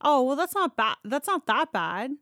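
normal talking voice

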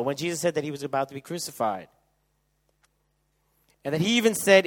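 loud, high preaching at full force; fervent preaching. Acted.

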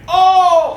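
loud, high imitated "oh"; crowd's shocked groan. Crowd seeing the arm break, loud mock groan. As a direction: wince out loud like a watching crowd.

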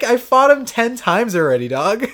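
ordinary delivery

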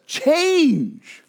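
"Change" high and falling; emphatic change. Shown as a demonstration. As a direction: ring out "Change" as the new word. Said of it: "How about this?".

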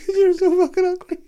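high, loud mock wail; imitating crying mum. Mum keeps insulting her bald son, mock tearful wail. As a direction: squeal it out in hammed-up tears. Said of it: "She just started crying right there".

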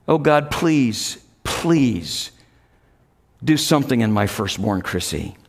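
slow, low plea sinking away; quiet pleading. Retold or imitated. Father praying for his firstborn daughter, quietly pleading.

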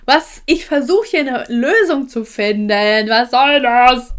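loud, high, drawn-out mock rant; exasperated outburst. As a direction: retell your outrage at full volume. Acted exasperated outburst at caller.

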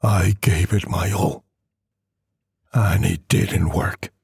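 plain reading voice

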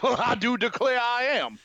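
a hammed-up Southern gentleman's drawl. Doctor mocked in a Southern drawl while he adjusts his belt. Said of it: "you know, southern drawl".

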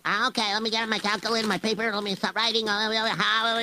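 high, fast busy babble; hurried calculating. Acted. Boy character doing calculations for his teacher, hurried babble. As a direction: rattle it off while working out sums.

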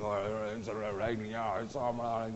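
mock grumpy neighbour. Mocking the grumpy man in the lift answering, low and sullen. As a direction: mumble like a grouch in the lift. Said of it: "that old grumpy guy".

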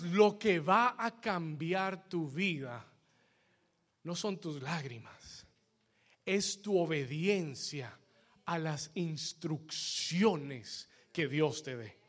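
low, soft, slow and deliberate; hushed, measured preacher's restatement; solemn repetition. Real, not acted. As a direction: let each phrase sink in, with pauses between. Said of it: "Se lo voy a repetir una vez más".

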